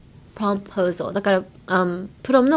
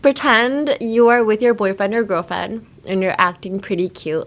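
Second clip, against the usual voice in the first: ordinary delivery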